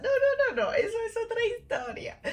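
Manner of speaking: high-pitched, loud refusal; playful protest. Acted. Waving off viewers' questions, squealed protest. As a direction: squeal it away, half laughing.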